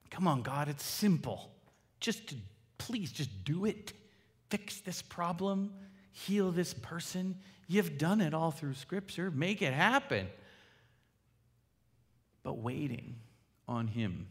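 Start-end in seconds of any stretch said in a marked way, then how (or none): 0.1-10.3 higher, slow, pleading demands to God; impatient pleading. Retold or imitated. Praying impatiently to God, mock pleading. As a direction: badger God like an impatient child. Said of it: "can be like that".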